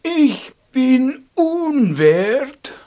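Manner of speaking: slow, drawn-out loud cry of unworthiness; loud, drawn-out call. Acted. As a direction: call it out loudly and slowly. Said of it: "und rief ganz laut".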